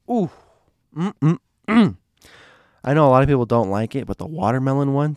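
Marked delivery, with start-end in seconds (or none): 1.0-2.0 savoring hum. Real, not acted. Savoring a favorite soda flavor.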